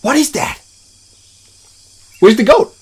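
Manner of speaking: normal talking voice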